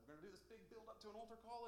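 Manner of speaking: high-pitched incredulous aside; mock dismay. Preacher recalling nobody coming to the altar, mock dismay. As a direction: voice it as a high, disbelieving complaint. Acted reaction to empty altar call.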